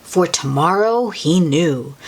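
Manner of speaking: ordinary delivery, nothing marked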